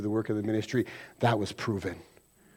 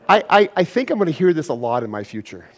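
usual speaking voice

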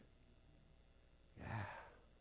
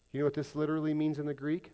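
ordinary talk, nothing marked

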